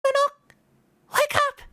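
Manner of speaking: put-on very high, urgent little girl. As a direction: squeak like a small girl waking someone. Voicing ghost girl waking him.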